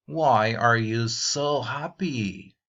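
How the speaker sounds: drawn-out clear question; slow model question. Shown as a demonstration. Teacher slowly reading an example to students. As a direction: read each word slowly.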